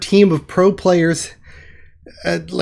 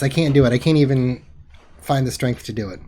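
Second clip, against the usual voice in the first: usual speaking voice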